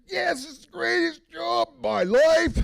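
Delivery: high, loud, slow gushing; a slacker gloating about his easy gig; mock delight. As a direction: ham up the joy of a lazy job. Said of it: "I was like".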